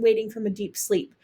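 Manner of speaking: usual speaking voice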